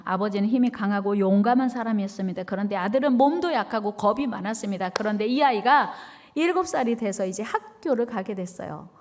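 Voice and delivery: ordinary talk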